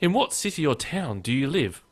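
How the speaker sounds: put-on, lilting pen-pal voice; mock email reading. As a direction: read the spam out with exaggerated lilting friendliness. Reading a spam email aloud.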